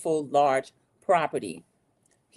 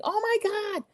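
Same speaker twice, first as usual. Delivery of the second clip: high, shocked gasp of concern; alarmed cry; 13 semitones higher than usual. Retold or imitated. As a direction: rush in frightened for her friend. Lisa reacting to crash.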